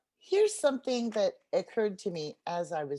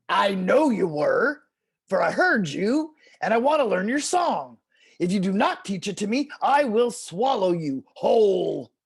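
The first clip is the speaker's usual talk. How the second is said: angry coyote; 8 dB louder than usual. Acted. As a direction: growl the threat at the turtle. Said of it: "Coyote got angry".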